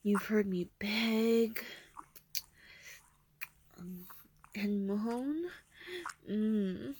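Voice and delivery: whispering. Acted. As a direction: whisper it close and teasing. Seductive whisper.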